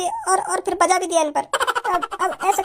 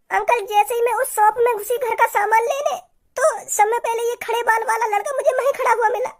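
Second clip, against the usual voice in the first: put-on higher girl's voice, half laughing; girl telling tales; 4 semitones higher than usual. Girl reporting to her uncle what the boy said, in a higher tattling voice.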